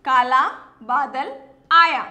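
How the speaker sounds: काला बादल आया read slowly, stressing the long आ; slow clear reading. Shown as a demonstration. Teacher reading the finished sentence to the class. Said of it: "मैंने बा को तेज की बोला".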